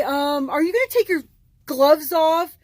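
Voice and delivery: a snooty, prissy coworker voice; mimicking coworker. Coworker voiced in retold story.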